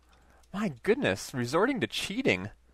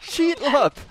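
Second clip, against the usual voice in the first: outraged shout; 14 semitones higher, 9 dB louder than usual. Real, not acted. Caught a cheater.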